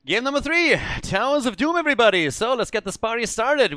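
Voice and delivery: high, bright announcer welcome; hyped opener. Real, not acted. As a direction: kick off the final map with energy. Caster opens final map.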